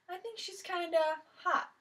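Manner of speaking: lightly voiced teenage boy; boy's voice. Boy admiring the new girl to his friend, a lightly voiced boy.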